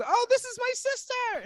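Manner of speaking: very high mock London accent; Izzy's London accent. Girl introducing herself to a Texan, high London accent. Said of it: "And she goes".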